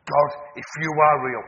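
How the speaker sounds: loud, acted cry to God; desperate prayer. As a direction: call out to God, voicing a desperate woman. Voicing a dragged woman's desperate prayer.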